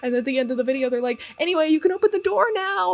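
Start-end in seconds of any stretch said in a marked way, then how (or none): 1.4-2.9 much higher, fast and breezy; cheery sign-off. Retold or imitated. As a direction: toss it off brightly like a prank host. Coworkers' cheery sign-off on tape.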